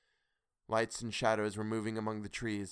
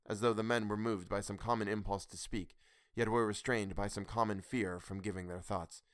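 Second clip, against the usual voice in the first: usual speaking voice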